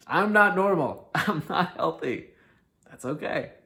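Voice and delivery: a cheerful, laughing admission; laughing declaration. Shown as a demonstration. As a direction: proudly own it with a laugh. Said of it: "repeated, louder and laughing".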